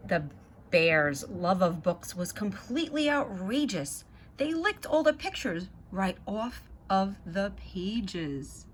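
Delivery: put-on lively read-aloud to children; story narration. A narrator's reading. Reading the Zoobrary book to kids, lively narration. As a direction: read it like a bedtime picture book.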